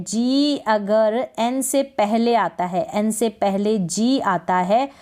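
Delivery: slow, careful rule statement. Shown as a demonstration. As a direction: spell the rule out slowly. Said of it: "teacher stating the rule". (धीमे से नियम समझाते हुए)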